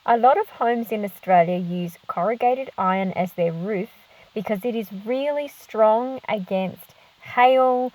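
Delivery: usual speaking voice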